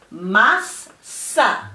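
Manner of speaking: "mas" rising, pause, "sa" falling; teacherly syllable-by-syllable "mas, sa"; separate syllables. Shown as a demonstration. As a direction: say "mas, sa" as two distinct beats. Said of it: "Those two syllables together are".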